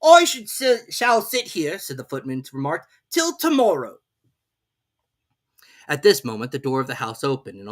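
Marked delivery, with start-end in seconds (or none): 0.0-1.8 high, slow, haughty footman; lofty servant talking down; footman's voice. A narrator's reading. Footman figure voice. 3.1-4.0 lofty servant talking down; footman's voice. A narrator's reading. Footman figure voice.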